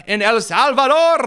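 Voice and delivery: mock Spanish lilt. Commentator mocking AOC's accent, hammed-up Spanish lilt. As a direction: lilt it like a hammed-up bullfight announcer. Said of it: "You have to lilt into the".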